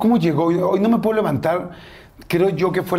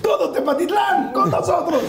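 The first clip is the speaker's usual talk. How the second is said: slow, much higher mock announcer call; tour bus caller; 13 semitones higher, slower than usual. Host teasing the guest about his aunt's bus trips, mock announcer call. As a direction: call out like a hammed-up excursion guide.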